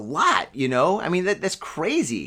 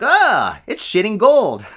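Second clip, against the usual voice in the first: amazed exclamation; 6 semitones higher, 7 dB louder, slower than usual. Retold or imitated. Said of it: "You're like".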